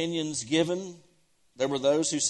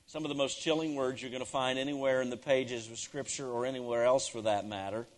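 ordinary talk

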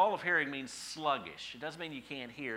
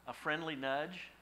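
ordinary talk

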